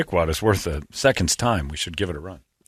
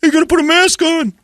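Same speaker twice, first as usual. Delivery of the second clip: much higher, louder put-on clerk voice; squeaky demand from the store clerk; 5 dB louder than usual. Store clerk telling a customer to wear a mask, in a high put-on voice. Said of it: "a guy goes".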